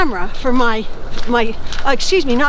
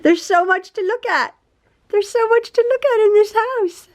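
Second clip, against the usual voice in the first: pet talk; 7 semitones higher than usual. Real, not acted. Cooing to the puppy.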